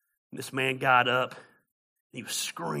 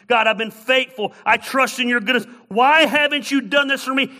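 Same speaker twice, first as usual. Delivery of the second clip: pleading that builds from firm to loud and high; anguished angry plea; 5 semitones higher, 8 dB louder than usual. Acted. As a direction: cry out to God as a wronged, hurt woman, building steadily. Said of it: "in her anger".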